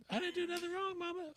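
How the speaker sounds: put-on high-pitched kid protesting to a parent; pleading child. Child to Mama before a whooping, high mock protest.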